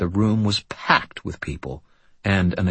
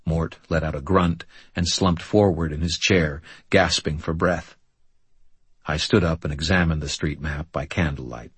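ordinary delivery, nothing marked

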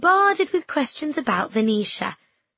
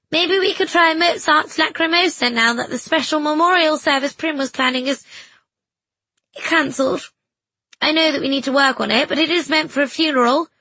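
higher, louder calling voice; 6 semitones higher, 5 dB louder than usual. A narrator's reading. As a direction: call across the church. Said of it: "Missus Quayle called".

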